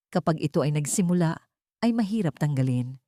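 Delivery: ordinary talk, nothing marked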